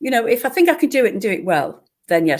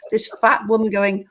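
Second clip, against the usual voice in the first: normal talking voice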